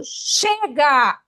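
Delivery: drawn-out, forceful cry; demonstrated shout. Shown as a demonstration. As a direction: shout it like breaking out of chains. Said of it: "eu vou pedir para você gritar". (grito forte de libertação)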